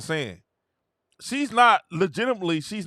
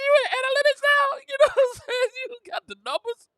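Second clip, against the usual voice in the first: very high, fast teasing; mocking squeal; faster than usual. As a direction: squeal it like a fake expert. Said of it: "Y'all like".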